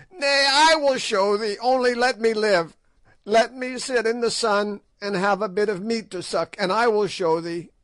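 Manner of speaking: a terrified hag bargaining for life; old witch pleading with the king; begging old witch. A narrator's reading. Gagool begs for her life.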